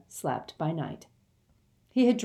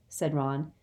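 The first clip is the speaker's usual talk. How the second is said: ordinary delivery, nothing marked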